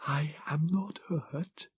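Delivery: high, slow, soft whisper; hushed Morlock whisper. Acted. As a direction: breathe it, no voice at all. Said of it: "he whispered".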